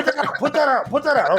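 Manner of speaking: high, urgent repeated order; mock panic. Acted alarm amid the boy's mishap.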